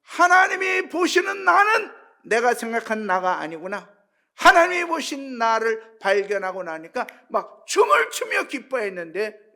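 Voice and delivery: preacher shouting his joy to the congregation; joyful shouting. Real, not acted.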